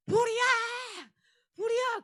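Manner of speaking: high, loud alarm cry of fire; shouting fire. Retold or imitated. Prank helpers yelling fire at the sleeping camp. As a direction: yell the alarm like the building is burning. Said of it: "한 세 사람이 이런 얘기를".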